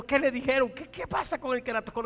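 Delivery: high, loud anxious questioning; hammed-up alarmed questions; mock panic. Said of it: "En lo humano era".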